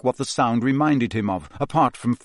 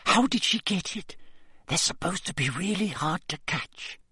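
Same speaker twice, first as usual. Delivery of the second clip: awed whisper; 7 semitones higher, 7 dB quieter than usual. A narrator's reading. Girls awed by unicorn.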